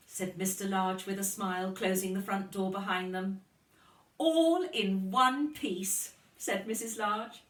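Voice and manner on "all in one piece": triumphant relief. Acted.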